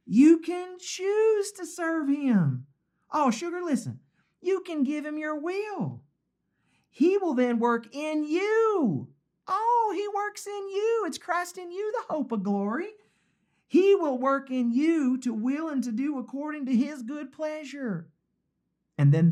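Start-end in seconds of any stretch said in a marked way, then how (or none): 0.0-17.3 very high, drawn-out Southern drawl; a hammed-up Southern lady, stretched out slow; Southern belle accent. Preacher has fun voicing the author, hammed-up Southern belle accent. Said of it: "Can't you hear it?". 17.3-18.1 high, softer Southern lilt; Southern belle accent. Acted. Southern belle finishes her counsel, high soft Southern accent. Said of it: "Southern belle saying".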